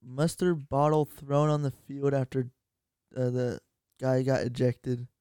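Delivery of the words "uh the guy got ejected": normal talking voice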